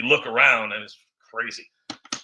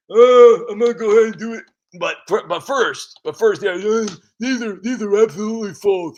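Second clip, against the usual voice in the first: Eric denying; 6 semitones higher, 9 dB louder than usual. Retold or imitated. Eric denies accusations.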